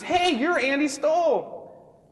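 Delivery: high, slow, drawn-out sing-song hey; cheerleader greeting. Retold or imitated. Tiffany the cheerleader captain greeting him, high slow coo. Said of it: "she goes".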